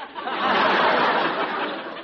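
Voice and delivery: crowd laughing; audience laughter. Real, not acted.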